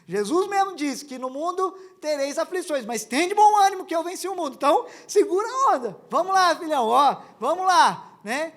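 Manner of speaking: put-on loud, high preacher pep talk to the baby; fired-up encouragement. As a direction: pump up the little one. (pai animando o bebê com voz alta e empolgada)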